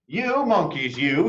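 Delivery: mild scolding peddler. A narrator's reading. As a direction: tell the monkeys off. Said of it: "he said, shaking a finger".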